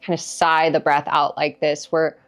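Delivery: calm meditation guide with long pauses; guided meditation. Acted. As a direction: ease listeners into their body, leaving space between phrases. Leads a guided meditation after a chatty intro.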